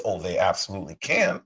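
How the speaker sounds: usual speaking voice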